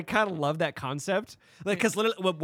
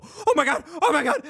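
higher, louder panicked oh my God; mock panicked buddy; 11 semitones higher, 7 dB louder than usual. Buddy being chased by a ghost, panicked and loud. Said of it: "Listening to your buddy go".

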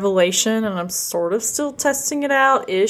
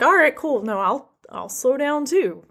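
higher-pitched easygoing dog's reply; a light, higher voice for an agreeable dog; lightly voiced dog; 7 semitones higher than usual. Trainer's friend voicing the golden in class, lightly higher-pitched. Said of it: "but she's like".

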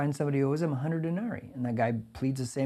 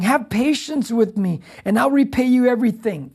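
pleading slave; 7 semitones higher, 8 dB louder than usual. Retold or imitated. Slave begging the king for more time, pleading loudly. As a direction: beg the king with everything. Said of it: "he's telling the king".

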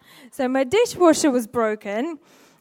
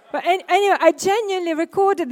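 laughing; 5 semitones higher, 5 dB louder than usual. Real, not acted. Laughs after the story.

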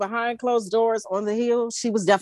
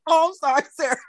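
high-pitched apology through giggles; real laughing; 8 semitones higher, much faster than usual. Real, not acted. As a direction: try to apologize while cracking up. Cracking up, apologizing between laughs.

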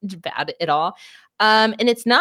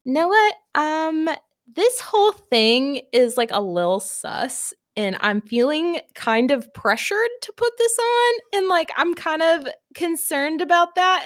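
high nervous voice; 8 semitones higher than usual. Retold or imitated. Voicing hesitant hero Mazin.

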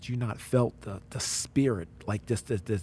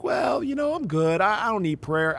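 higher-pitched, self-assured brush-off; dismissive attitude; 8 semitones higher than usual. Retold or imitated. Intern turning down prayer from his group, dismissive. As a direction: wave the offer away, pitched up. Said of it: "I had that attitude".

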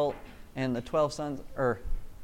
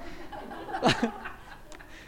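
laugh; 5 semitones higher, 6 dB quieter than usual. Real, not acted. Laughs at own joke.

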